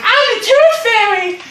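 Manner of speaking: put-on high, drawn-out little girl. Little girl showing off her costume, high proud child voice.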